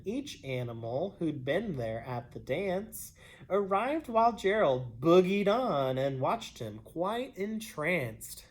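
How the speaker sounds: ordinary delivery, nothing marked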